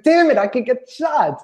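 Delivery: a hammed-up flash of fear; mock panic. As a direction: suddenly realize the danger, half joking. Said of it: "It's like".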